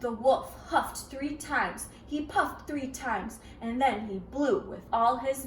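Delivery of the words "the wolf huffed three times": plain reading voice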